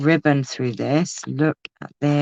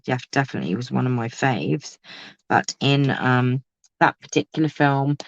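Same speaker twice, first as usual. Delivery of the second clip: ordinary talk, nothing marked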